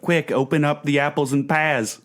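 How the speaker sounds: a panicked Cockney in rhyming slang, overdone; mock Cockney. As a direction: ham up a Cockney urgent call. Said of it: "It's like".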